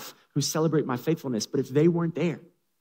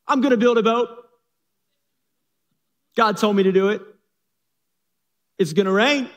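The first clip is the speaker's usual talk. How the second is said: higher and louder, dead certain; eager Noah; 7 semitones higher, 6 dB louder than usual. Acted. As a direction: announce the boat plan with zeal. Noah explains to wife.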